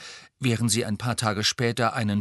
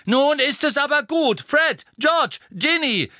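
loud, much higher call to order; exasperated call; 7 dB louder than usual. Acted. Mother calling her singing children to stop, loud and high. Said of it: "rief Misses Weasley".

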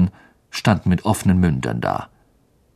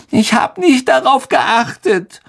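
flaring up; 14 semitones higher than usual. Acted. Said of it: "sagte Myrte aufbrausend".

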